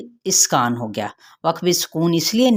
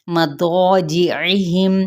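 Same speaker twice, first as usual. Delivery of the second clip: very slow, drawn-out tajweed reading; slow Quran recitation; much slower than usual. Shown as a demonstration. Teacher reciting slowly for her students. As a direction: stretch the word out as in recitation. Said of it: "teacher recites Quran word".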